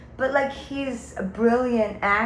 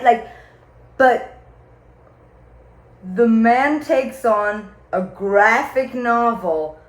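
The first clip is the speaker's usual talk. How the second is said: normal talking voice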